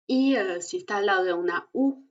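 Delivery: ordinary talk, nothing marked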